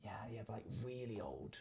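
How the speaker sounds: hushed whisper; breathy whispered emphasis. Real, not acted. Stressing how old the roof is.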